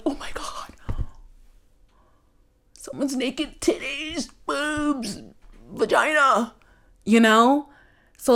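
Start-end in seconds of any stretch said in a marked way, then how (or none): 0.0-6.5 shocked prude. Retold or imitated. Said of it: "some people are like".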